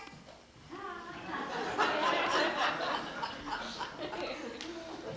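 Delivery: long high hearty laugh. Acted. Tutor laughing at the awkward role he plays, hearty. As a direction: let a big laugh roll out.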